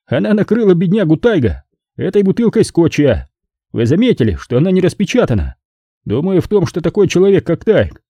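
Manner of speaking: high mocking man's voice; sarcastic Courtney. A narrator's reading. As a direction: savour the irony coldly. (язвительный высокий мужской голос)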